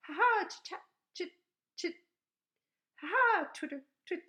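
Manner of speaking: high, loud chirpy chatter; swallow chatter. Retold or imitated. Reading the swallow's call, high chirpy chatter.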